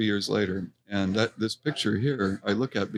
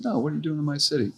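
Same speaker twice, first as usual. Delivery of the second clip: put-on higher, faster American-sounding accent; American-style accent; 7 semitones higher, faster than usual. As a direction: mimic the stranger's American-ish accent, quick and high. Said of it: "Kind of an American way in a way. But with an accent".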